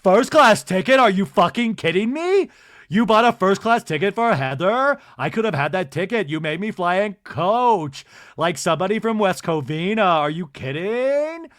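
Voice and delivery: loud, indignant imagined rant; loud, hammed-up outrage over a first-class ticket; mock outraged rant. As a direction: rant as Lisa would have. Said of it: "waiting so much for Lisa Barlow to be like".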